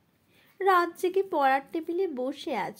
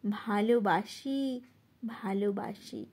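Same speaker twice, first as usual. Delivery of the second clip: tender reply; 5 semitones lower, 4 dB quieter than usual. Acted. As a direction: answer tenderly, sinking softer on the repeat. Tender answer in the poem's refrain. (কোমল, নিচু স্বরে)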